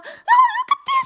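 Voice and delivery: very high, loud, slow outburst; mock exasperated scold. Coworker points at the waitlist, very high slow outburst. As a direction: drag it out in high fury.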